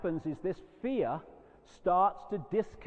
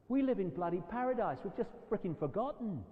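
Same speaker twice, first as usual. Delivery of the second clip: normal talking voice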